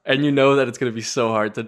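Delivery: usual speaking voice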